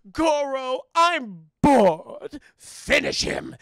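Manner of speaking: loud, booming order to a henchman; acted villain command. Shang Tsung ordering Goro to finish Jax, villain's loud command. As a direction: play the bored tyrant at full volume.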